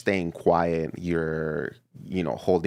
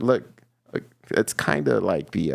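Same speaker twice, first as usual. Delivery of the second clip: ordinary talk, nothing marked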